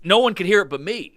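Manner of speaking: ordinary talk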